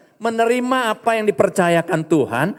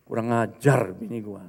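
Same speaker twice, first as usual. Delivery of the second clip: low, soft Betawi-slang grumbling, much lower pitch; angry Betawi grumble; 11 semitones lower, 6 dB quieter than usual. Retold or imitated. Husband fuming about his wife, low Betawi grumble. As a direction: mutter it in Betawi street slang like a fed-up husband. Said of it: "Ini bahasa Betawi ya".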